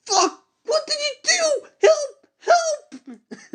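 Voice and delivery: extremely high squealing cries, slow; hammed-up terrified shriek. Hero being devoured shrieks for help, extremely high hammed-up squeal. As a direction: shriek half-laughing as they eat you.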